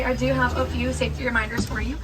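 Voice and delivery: ordinary talk, nothing marked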